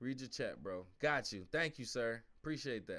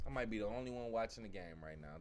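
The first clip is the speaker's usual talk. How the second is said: muttered; 8 semitones lower, 6 dB quieter than usual. Real, not acted. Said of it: "repeated quieter".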